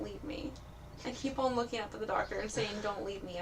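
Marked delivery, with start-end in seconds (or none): 2.8-3.4 quiet begging. Pretend. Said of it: "I'm begging him".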